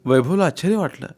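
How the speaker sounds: usual speaking voice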